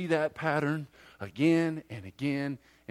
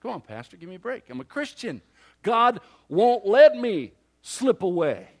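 defensive complacent believer; 3 semitones higher, 8 dB louder than usual. Retold or imitated. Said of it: "do you say to yourself".

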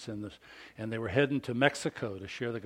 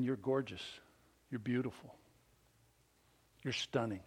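softer, gentle fatherly praise; tender dad; 9 dB quieter than usual. Retold or imitated. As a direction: murmur it like a proud dad. Dad's loving words to daughter.

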